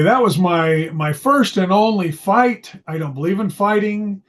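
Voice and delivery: ordinary delivery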